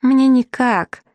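high, drawn-out plaintive cry; helpless call. A narrator's reading. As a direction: call back slowly from where you are stuck. Stuck girl calls from under tent.

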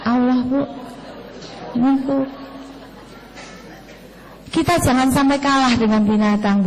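usual speaking voice

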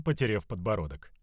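ordinary delivery

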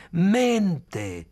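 high, loud accusing shout; angry shout. A narrator's reading. Mechanic accusing Khalil, a loud high shout. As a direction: yell it like an accusation. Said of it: "gridò".

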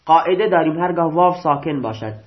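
ordinary talk

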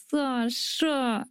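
soft, despairing groaning call; doomed groan. A narrator's reading. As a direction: groan the name in defeat. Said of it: "издал обречённый стон Тик".

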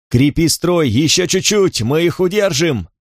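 high, urgent rallying shouts; rally the men, yelling; shouting. Acted. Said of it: "кричал Катон".

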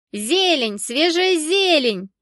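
loud market cry, rising high and stretched out; hawker's yelling. A narrator's reading. Greens seller to passers-by, loud drawn-out yelling. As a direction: sing out your wares at full voice. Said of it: "разорялась женщина".

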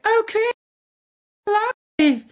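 very high, hammed-up game heroine; bad voice acting. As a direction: squeal it like terribly acted game dialogue. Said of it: "some of the worst voice acting ever".

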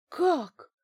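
high, loud startled question; shocked gasp. A narrator's reading. Bankina hearing a baffling instruction, shocked gasp. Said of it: "ахнула Банкина".

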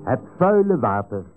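normal talking voice

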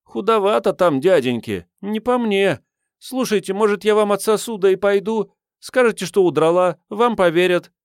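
put-on high, loud-edged girlish voice; cheeky waif bargaining with grown men; brazen street girl. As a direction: pitch it up high and wheedle. Voices the street girl's dialogue.

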